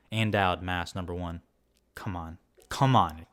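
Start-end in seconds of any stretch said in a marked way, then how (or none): 1.9-2.4 low murmured plea, almost to himself; quiet hopeful come on under the breath. Real, not acted. Hopeful cheer for a favorite nominee. 2.7-3.2 louder cheer, pushing for it; louder come on. Real, not acted. Hopeful cheer for a favorite nominee.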